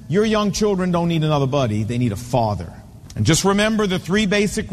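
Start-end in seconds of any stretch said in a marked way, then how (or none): none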